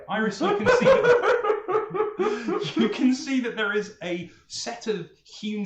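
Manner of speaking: slow and high, broken by laughter; laughing. Real, not acted. Game master laughing as he describes the stairs to Iris. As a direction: describe it while still cracking up.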